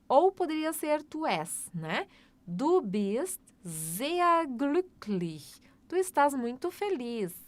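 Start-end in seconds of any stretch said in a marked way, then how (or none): 2.5-5.6 Du bist sehr glücklich spoken very slowly, word by word; slow classroom dictation; very slow model sentence. Shown as a demonstration. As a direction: drag each word out for learners. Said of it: "slow model sentence".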